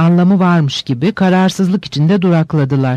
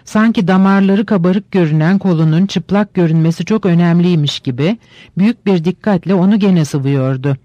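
plain reading voice

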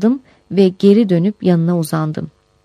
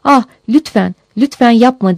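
mildly raised, pleading voice; 4 semitones higher than usual. A narrator's reading. Victim begging her attacker to stop, pleading. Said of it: "diye yalvardım".

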